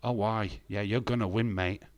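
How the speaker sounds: usual speaking voice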